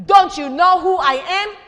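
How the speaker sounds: loud, high, offended question; indignant. A narrator's reading. Vain donkey demanding the people recognize him, indignant and loud. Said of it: "the donkey said crossly".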